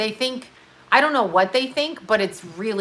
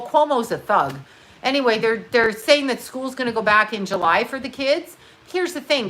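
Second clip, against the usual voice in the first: ordinary delivery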